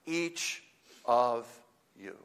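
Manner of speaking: each word set apart with pauses. Shown as a demonstration. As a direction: answer firmly, one separate word at a time. Answers objection, word by word.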